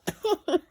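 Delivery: short high burst of laughter; giggle. Real, not acted. Child giggling at the silly duck picture.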